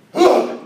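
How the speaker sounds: loud, high exasperated "huh"; frustrated huff. Acted. Uncle giving in out of panic and frustration, loud huff. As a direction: burst out with a panicked, frustrated grunt. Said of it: "panic and frustration, Bob just goes".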